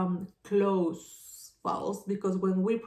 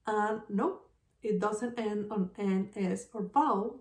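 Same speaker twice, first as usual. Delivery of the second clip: ordinary talk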